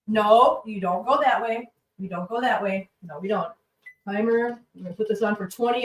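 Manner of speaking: normal talking voice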